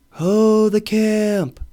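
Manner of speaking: high, slow hail into the dark; calling out. Acted. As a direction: call out across the camp. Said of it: "I called into the night".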